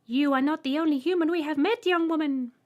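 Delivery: very high, loud piercing voice; Trembler. A narrator's reading. Alien greeting the human, piercing high voice. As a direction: squeak it out politely. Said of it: "the Trembler Ethel's left stated".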